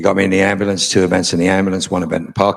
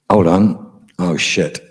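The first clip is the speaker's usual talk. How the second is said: usual speaking voice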